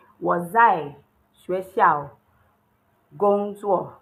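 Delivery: slow model sentence. Shown as a demonstration.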